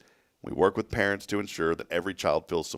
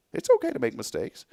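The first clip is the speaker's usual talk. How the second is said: high aside. Real, not acted. Echoes line aside, high pitch.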